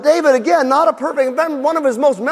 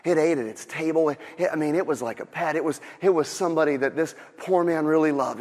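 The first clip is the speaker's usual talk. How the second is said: low, soft, tender telling; emotional storytelling; 8 semitones lower, 9 dB quieter than usual. Acted. Nathan tells David of the pet lamb, emotional. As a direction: play gently on the heartstrings. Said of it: "Nathan really turns on the, the emotional".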